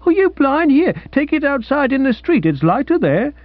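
put-on squeaky furious old dealer; exasperated dealer. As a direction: scold him as if he's blind, pitched high.

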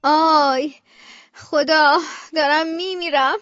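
drawn-out groaning lament; acted, slow cry of pain; moaning in pain. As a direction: moan aloud with a bellyache. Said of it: "داره بلند بلند میگه".

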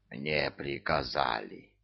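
low, soft, slow wheeze; hoarse, worn-out old voice; hoarse old demon. A narrator's reading. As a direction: wheeze it out slowly, deep and weak. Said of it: "просипел заслуженный".

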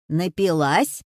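put-on low, loud old woman's challenge; loud, suspicious old woman's question; scolding old woman. A narrator's reading. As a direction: demand it like an accusing granny. Old woman accosts sleeping girl.

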